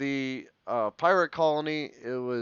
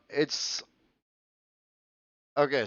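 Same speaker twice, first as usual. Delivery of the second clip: normal talking voice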